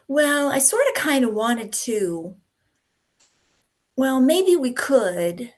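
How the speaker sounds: hesitant, noncommittal demo with drawn-out words; demonstrating wishy-washy filler phrases. Shown as a demonstration. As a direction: hedge it, as if unable to commit. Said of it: "superfluous words like, and phrases like".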